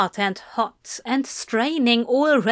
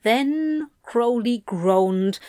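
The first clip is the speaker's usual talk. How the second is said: plain reading voice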